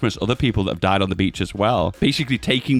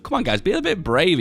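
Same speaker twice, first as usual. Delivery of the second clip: usual speaking voice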